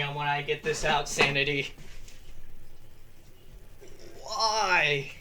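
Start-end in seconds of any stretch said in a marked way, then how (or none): none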